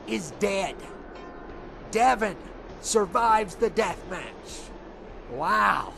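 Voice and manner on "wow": normal talking voice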